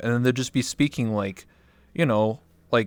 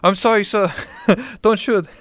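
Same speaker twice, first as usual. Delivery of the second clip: mock pleading; 10 semitones higher, 8 dB louder than usual. Mock begging after ranch threat.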